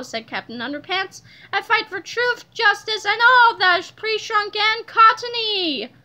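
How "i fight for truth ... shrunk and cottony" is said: raised voice, slowing to the finish; heroic declaration. A narrator's reading. Superhero telling police his mission, declaiming proudly.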